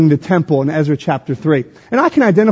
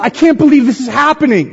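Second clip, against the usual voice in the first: higher-pitched, mildly incredulous; can hardly believe it, voice lifted; amazed disbelief; 7 semitones higher, faster than usual. Acted. Preacher voicing the psalmist to the church, amazed disbelief.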